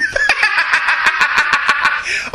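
long, high helpless laugh; laughter. Real, not acted. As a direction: crack up at an innuendo. Laughs at innuendo in email.

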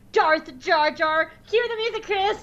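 high-pitched playful cue to the co-host; mock-dramatic announcement. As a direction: announce it high, like a villain's entrance. Calls to co-host for theme music.

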